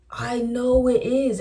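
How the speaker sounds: fed up at being corrected; exasperated retort. Retold or imitated. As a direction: push back, stretching each word.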